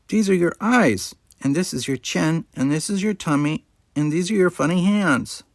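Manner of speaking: put-on high, sing-song little girl's voice; a small child playing pretend; toddler chatter. A narrator's reading. Molly chatters to herself over her painting, a high toddler voice. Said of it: "chatter away just as if talking".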